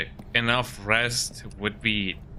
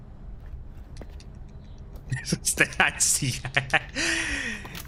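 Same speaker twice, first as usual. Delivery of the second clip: high laugh; 9 semitones higher, 4 dB quieter than usual. Real, not acted. Laughing at the kill.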